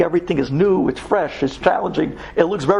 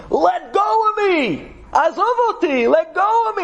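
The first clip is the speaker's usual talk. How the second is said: anguished screaming; 13 semitones higher than usual. Retold or imitated. Possessed Israeli at the gate screaming to be released, high. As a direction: scream as if held by an unseen force. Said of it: "he's yelling and screaming".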